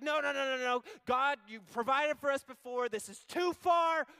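loud, high protesting no's; panicked refusal. Acted. Israelites refusing to enter promised land, loud whiny protest.

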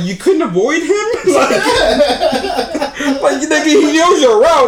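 mock-scold while cracking up; laughing rant. Real, not acted.

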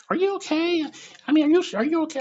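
high, loud worried voice of the crowd; concerned strangers. Retold or imitated. Onlookers behind him asking if he is okay, high and loud. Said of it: "the people behind me are like".

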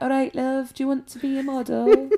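attempted Scouse accent. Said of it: "No, I can't do it".

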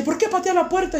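high-pitched accusing teacher imitation; scolding teacher. Old teacher asks about kicked door, high scolding.

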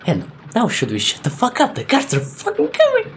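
high, rising urgent hush-turned-cry; excited panic. Acted. Wizard girl warning the party, high excited alarm. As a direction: rush it like the guards are on top of you. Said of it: "super fucking excited all of a sudden".

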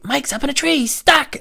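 put-on very high, loud kid voice; excited kid. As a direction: call out like a worried child. Kids calling out.

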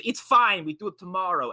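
high, loud brush-off; breezy director. Retold or imitated. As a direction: wave her off carelessly. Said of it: "He goes".

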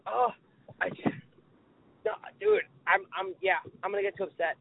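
ordinary delivery

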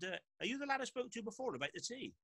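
lightly voiced impatient manager; mock impatient Clough. Clough calls back, impatient.

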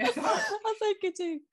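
high, slow words through a real laugh. Real, not acted. Admitting to the host she has a filter, laughing. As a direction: giggle the admission out.

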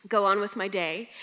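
ordinary talk, nothing marked

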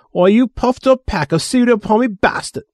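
high, outraged snarl of insult; angry growl. Acted. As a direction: spit the insult out in fury. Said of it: "Jane Fingal growled".

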